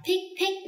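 put-on "Pig, pig" read the old Vietnamese classroom way, pitched high; traditional Vietnamese-style reading. Shown as a demonstration. Teacher showing parents the old way of reading "Pig, pig", Vietnamese-style. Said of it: "cách học truyền thống".

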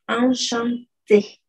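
enchanté drawn out very slowly with nasal an; French enchanté. Shown as a demonstration. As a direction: stretch enchanté for the class. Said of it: "Se pronuncian tal cual".

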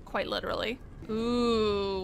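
usual speaking voice

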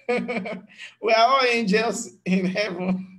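a hammed-up giggling imitation of a smug churchgoer; mocking through laughter. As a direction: laugh as you parody the claim.

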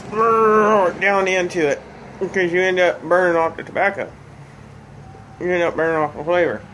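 talking around pipe stem; pipe in mouth. Real, not acted. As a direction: lips clenched on stem. Seen 0: Pipe stem held in mouth while talking, lit at start.

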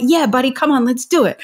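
high, rallying parent voice; enthusiastic encouragement. Acted. Parent joining a child throwing socks, rallying and keen.

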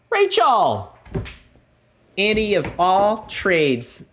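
light up at seeing her; excited call. Real, not acted. Host greeting a viewer in chat with excitement.

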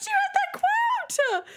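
very high squealing question; laughing squeal. Real, not acted. Streamer asking a chatter, very high laughing squeal. Laughing at chat's added quote.

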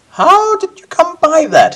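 very high, loud, slow question; Baindon's voice. Acted. Guildmaster suddenly inquisitive about the amulet, high slow voice. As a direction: ask it slowly in a high put-on voice. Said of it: "he asks".

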